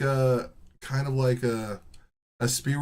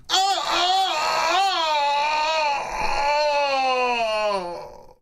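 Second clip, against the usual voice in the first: faked orgasm moan; 13 semitones higher, much slower than usual. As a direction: fake a climax with hammed-up rising moans that tail off. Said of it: "you just fake it".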